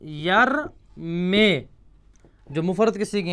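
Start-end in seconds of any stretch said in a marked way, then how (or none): 0.0-1.6 یرمے stretched very slowly, each sound held; teacher spelling out یرمے; drawn-out model word. Shown as a demonstration. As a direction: say یرمے slowly and clearly for the class. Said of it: "یرمی سے لم".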